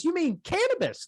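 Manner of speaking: squeaky high surprised question; surprised neighbor. Retold or imitated. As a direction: pitch it way up, incredulous. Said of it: "And he goes".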